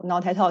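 "not at all" said as "nao-tai-tao"; nickname from a mispronunciation. Retold or imitated. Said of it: "结果让这个名字背了".

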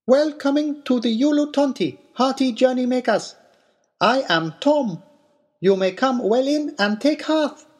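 a small odd host greeting in halting English; broken English. A narrator's reading. Little man welcoming travellers, high and halting. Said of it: "the little man replied in broken English".

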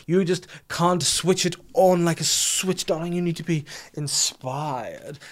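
high, slow, airy luvvie artist voice; whimsical artist. Retold or imitated. Patronizing his wife as the whimsical creator, hammed-up luvvie drawl. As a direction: lay it on like a precious artiste. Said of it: "I like to be like".

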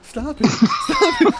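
whiny mock plea, getting squeakier; imitating a feeble wrestler begging; weak protest. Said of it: "he was kinda like".

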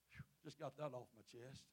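usual speaking voice

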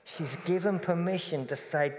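usual speaking voice

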